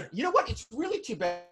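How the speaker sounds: laughing burst, way up high and loud; real laugh spilling into mock outrage. Real, not acted. Teased by the host, laughing high and loud. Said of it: "thank you for breaking the rhythm".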